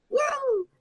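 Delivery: very high cheering whoop; mock audience woo. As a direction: whoop along like a studio crowd. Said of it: "like, the applause would happen".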